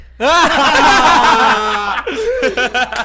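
loud laughing shout; big burst of real laughter. Real, not acted. Laughs at his own story. (gargalhada alta)

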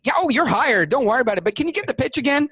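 hammed-up thrilled board members; gushing excitement. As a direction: imitate fans begging for an encore. Board hires them, wants more pitch.

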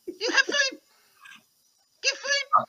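very high squeaky rat begging; hungry rat pleading; eager rat voice. Acted.

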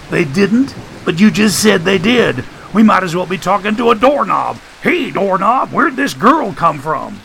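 high, incredulous character voice; high-pitched sarcastic exasperation; exasperated Joshua. Acted. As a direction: throw up your hands in a high voice.

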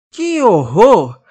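loud, slow outraged gasp; loud drawn-out dismay; shocked. Acted. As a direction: express horror at the news. Girl outraged.

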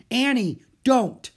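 loud high warning; urgent warning. A narrator's reading. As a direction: call out to stop her. Jack warns.

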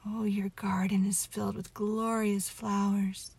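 plain reading voice

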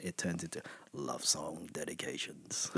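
soft, slow love song dedications host; late night radio voice. Shown as a demonstration. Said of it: "if I really wanna turn it on".

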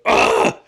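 sharp loud yelp, imitating Josh waking; retold yell. Imitating Josh jolting awake at the bar, loud yelp. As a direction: blurt a startled loud "Ah!". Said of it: "he yells".

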